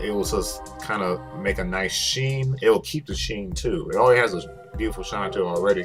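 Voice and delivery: ordinary talk, nothing marked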